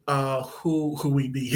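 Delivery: ordinary talk, nothing marked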